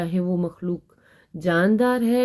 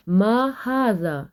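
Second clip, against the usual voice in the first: slow Arabic read; much slower than usual. Shown as a demonstration. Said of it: "اس کے بارے میں ہو رہا ہے سوال".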